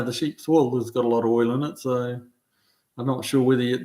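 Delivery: ordinary delivery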